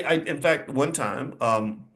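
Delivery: ordinary delivery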